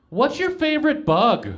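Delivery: high, loud and slow, with a laugh; odd question. Retold or imitated. Tripping guy asking his partner mid-thrust, high and loud. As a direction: blurt it out of nowhere, way up high. Stopping mid-sex to ask.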